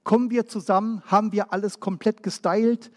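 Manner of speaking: ordinary talk, nothing marked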